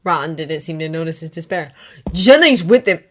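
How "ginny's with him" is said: loud insistence. Acted. Ron insists loudly.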